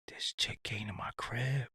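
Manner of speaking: shocked whisper; hushed, scared whisper; breathless whisper of disbelief. Retold or imitated.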